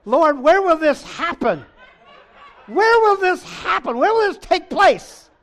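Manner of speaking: high, loud hammed-up disciples' question; exaggerated shouted plea; mock-pleading shout. Acts disciples' urgent question.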